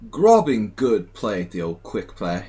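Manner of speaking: mock-British, loud, drawn-out opening; British accent. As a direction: play a hearty caricature of a British accent. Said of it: "My British accent needs some, needs some brushing up".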